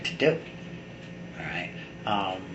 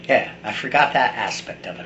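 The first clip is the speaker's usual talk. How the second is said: normal talking voice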